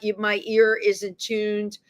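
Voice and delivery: ordinary talk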